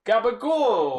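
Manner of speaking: Gabagool said high and drawn out, New York Italian style; hammed-up Sopranos gabagool; the word Gabagool in a mock mobster accent. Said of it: "Next word is gabagool".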